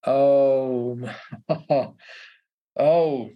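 long, loud "oh" with a laugh; dawning realisation. Retold or imitated. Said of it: "And I went".

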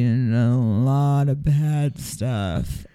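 low, drawn-out mock-poet delivery; mock poetry recital. Mocking a man's poetry to a friend, low mock recital. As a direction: recite it ponderously, slowing right down on the last phrase.

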